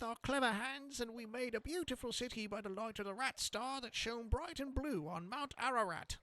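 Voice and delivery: high, gruff squeaking rat voice. Acted. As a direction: growl it out like a proud rat rebel. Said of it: "try to do a rat voice that is gruff".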